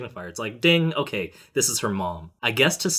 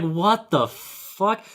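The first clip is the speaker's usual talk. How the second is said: drawn-out, high, incredulous question; baffled disbelief; 9 semitones higher, much slower than usual. Retold or imitated. Podcaster recalling a weird movie scene, baffled disbelief. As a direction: stretch it out in utter disbelief. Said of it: "I was just like".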